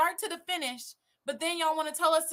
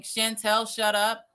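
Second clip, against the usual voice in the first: louder, mock brush-off; mock-commanding, raised voice; imitated dismissal; 3 semitones lower, 7 dB louder, slower than usual. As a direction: act out a man cutting her off. Acting out his dismissal.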